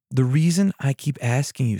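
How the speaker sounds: normal talking voice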